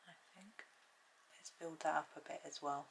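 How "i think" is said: whisper. Real, not acted. Whispered aside while painting.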